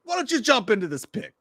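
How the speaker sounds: high mock Italian accent; Italian exorcist baiting a demon; Russell Crowe. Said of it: "that's kinda how he's talking".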